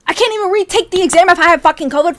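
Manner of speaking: high, loud, fast outburst; indignant rant. Real, not acted. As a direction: rail at an unfair rule. Mock rant at covid testing rule.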